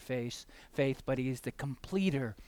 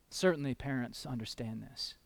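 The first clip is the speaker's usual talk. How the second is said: ordinary talk, nothing marked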